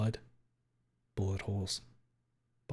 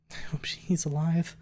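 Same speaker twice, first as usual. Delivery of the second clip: mumble; 5 semitones higher than usual. Acted. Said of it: "she mumbles out".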